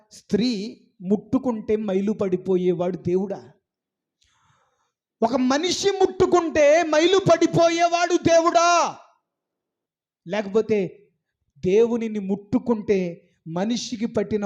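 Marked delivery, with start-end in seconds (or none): 5.2-9.0 higher, louder, indignant challenge; a full-voiced preacher's rebuke; preaching shout. Real, not acted. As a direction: shout the question at the congregation.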